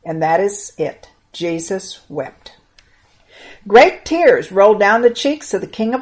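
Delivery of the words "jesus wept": very slow, each word given weight; slow, weighty. Shown as a demonstration. Teacher repeating the shortest verse for children, very slow. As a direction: let the two words sink in slowly.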